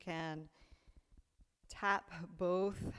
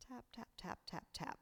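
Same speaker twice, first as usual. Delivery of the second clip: whispered; 4 semitones higher, 7 dB quieter than usual. Acted. Rhythmic tapping cue in exercise.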